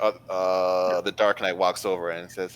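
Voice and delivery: ordinary talk, nothing marked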